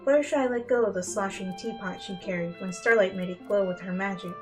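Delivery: ordinary delivery, nothing marked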